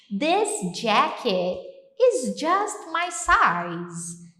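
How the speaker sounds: very slow careful phrase; slow English model. Shown as a demonstration. Teacher modelling the phrase for students, slow. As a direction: stretch every word for learners. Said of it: "Essa hora que my husband".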